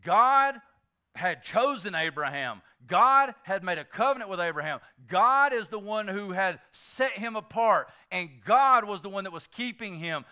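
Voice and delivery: ordinary delivery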